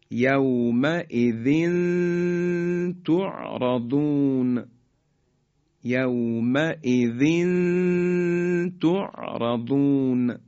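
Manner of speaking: slow یومئذ تعرضون with the final n of یومئذ hummed long; measured Quranic recitation of یومئذ تعرضون, long vowels drawn out; tanwin ikhfa recitation. Shown as a demonstration. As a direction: hide the tanwin of یومئذ in a held nasal tone before تعرضون. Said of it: "تنوین رسید به طی دو نقطهٔ".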